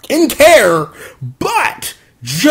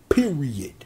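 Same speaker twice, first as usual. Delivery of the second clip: whispered; 6 semitones lower, 10 dB quieter than usual. Real, not acted. Host closing his verdict to listeners, whispered. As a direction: whisper the final word. Hushed final word.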